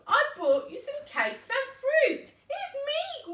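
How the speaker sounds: high put-on sweet voice; wolf faking sweetness. Wolf faking the girl's voice at Grandma's door, high. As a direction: pretend to be the little girl. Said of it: "he called".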